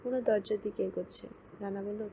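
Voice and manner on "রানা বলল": ordinary delivery, nothing marked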